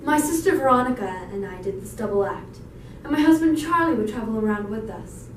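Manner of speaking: projected stage storytelling; loud theatrical monologue delivery; dramatic audition monologue. Acted. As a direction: play it out to the audition panel.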